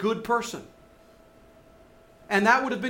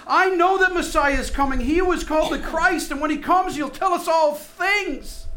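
reach out in longing wonder; hopeful wonder; 8 semitones higher, 5 dB louder than usual. Retold or imitated. Woman at the well telling Jesus of the Messiah, full of longing wonder. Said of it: "desperation and that hope and that wonder".

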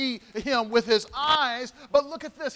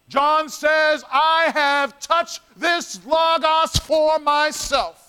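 louder, slow, driving each word; loud preaching climax; 3 semitones higher, 6 dB louder, slower than usual. Acted. Preacher proclaiming John's words to the congregation, loud and slow. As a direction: build to a shout of testimony.

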